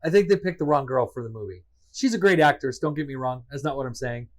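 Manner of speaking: usual speaking voice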